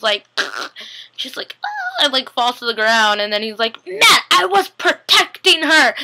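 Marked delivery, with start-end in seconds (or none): 1.6-2.0 very high little "ah"; high-pitched imitated yelp; mock cry. Imitates Caroline's cry as she falls. 3.9-5.9 high, slow mock whine of protest; hammed-up childish frustration, drawn out; frustrated little kid. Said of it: "he says that all frustrated".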